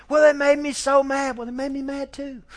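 put-on high-pitched, protesting kid voice; indignant child. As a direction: whine it back like a cross little kid. Acts out child's angry excuse.

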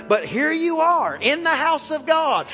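slow, loud and high fervent cry; preaching climax. Real, not acted. Preacher crying out to the congregation about the saved. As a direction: stretch each phrase out at full force.

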